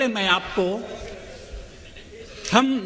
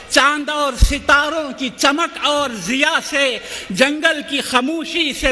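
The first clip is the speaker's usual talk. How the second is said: rhythmic devotional verse, building intensity; reciting a hamd; 5 semitones higher, 3 dB louder than usual. Acted. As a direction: chant each line in a swelling list.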